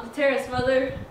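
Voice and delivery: called out loud to another room; calling out. Acted. Tom calling to his mother from the terrace. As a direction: project it to Mother inside.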